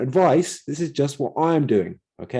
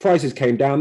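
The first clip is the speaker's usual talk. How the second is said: ordinary talk, nothing marked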